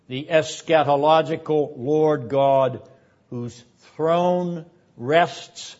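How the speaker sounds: ordinary talk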